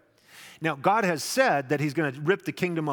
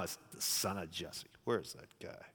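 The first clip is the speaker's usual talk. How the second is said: contemptuous Saul; 8 semitones lower, 13 dB quieter than usual. Acted. Saul's actual contemptuous version.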